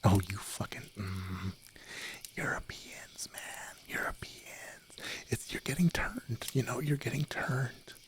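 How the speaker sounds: mock-exasperated whisper; ASMR whisper. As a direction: whisper it with playful disbelief. Whispered ASMR stream chatter.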